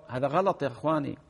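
normal talking voice

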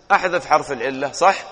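normal talking voice

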